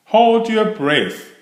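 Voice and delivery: put-on a young friend with a tip; Rabbit's advice. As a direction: offer it brightly as Rabbit. Rabbit voiced by narrator.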